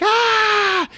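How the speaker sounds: high, loud comic shriek; short hammed-up scream of terror; mock scream. Kirsty seeing her dead dad, mock scream.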